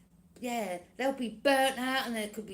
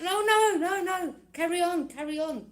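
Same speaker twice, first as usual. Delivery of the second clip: high, loud teasing insistence; mock stern urging; 9 semitones higher, 8 dB louder than usual. Someone telling the burdened enemies to keep going, mock urging. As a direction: push them on mockingly.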